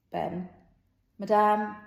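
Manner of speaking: plain reading voice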